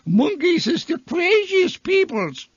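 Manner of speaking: very high, comic Bavarian voice; rich Bavarian accent. Acted. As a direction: squeal it in a cartoonish Bavarian accent. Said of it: "with a rich Bavarian accent".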